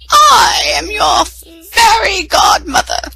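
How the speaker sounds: high, slow, sing-song fairy voice; fairy godmother. A narrator's reading. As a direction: announce yourself with magic. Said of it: "said the little woman".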